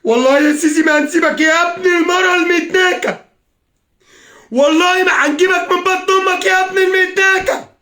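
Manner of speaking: high, sustained roar of rage; real screaming fury; furious screamed oath. Real, not acted. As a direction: hurl the threat at full voice. Swearing revenge at the president.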